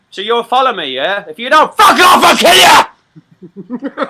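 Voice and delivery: retold shout breaking into a laugh; shouted threat. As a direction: yell it in his face.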